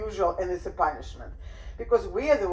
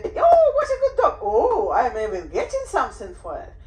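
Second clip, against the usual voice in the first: shower the dog with praise; 2 semitones higher, 5 dB louder than usual. Shown as a demonstration. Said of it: "You say".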